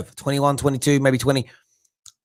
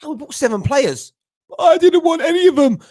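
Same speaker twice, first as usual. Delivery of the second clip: mock Lampard; 11 semitones higher, 4 dB louder than usual. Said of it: "sitting there as Lampard".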